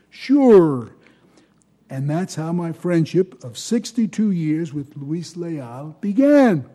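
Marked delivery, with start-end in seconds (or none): 0.1-0.9 a quick, lightly voiced Chicago accent. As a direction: toss off a casual Chicago accent. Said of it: "he replied in his best Chicago accent".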